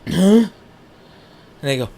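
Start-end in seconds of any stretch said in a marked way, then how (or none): 0.1-0.5 a sudden, loud, high huh on waking; jolted awake, blurt it out loud; groggy huh. Retold or imitated. Waking from smelling salts.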